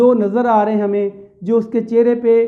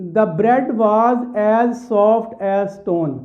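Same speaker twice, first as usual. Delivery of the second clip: slow reading; much slower than usual. Shown as a demonstration. Teacher reads poem example slowly.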